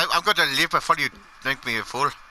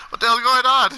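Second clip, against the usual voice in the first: higher, louder baffled outcry; louder, higher what the hell; loud exclaim; 8 semitones higher, 6 dB louder than usual. Real, not acted. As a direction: blurt it out in disbelief.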